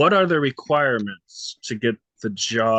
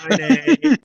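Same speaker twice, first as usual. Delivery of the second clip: cheerful goodbye; 12 semitones higher, 10 dB louder than usual. Real, not acted. Saying bye to a child.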